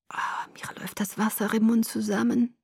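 breathy, hushed craving; hungry murmur. A narrator's reading. Laurent sniffing his prey's hair, hungry hushed murmur. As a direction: savour the scent in a near-whisper. Predator savours scent of his prey.

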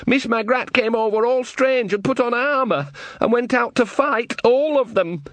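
anxious son urging his mother to act; eager young Sean. Acted. Sean's young voice, narrator plays him.

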